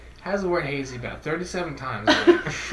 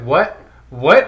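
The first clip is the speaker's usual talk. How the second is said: mock shouting over noise; 2 semitones lower, 11 dB louder than usual. Can't hear customer in busy bar.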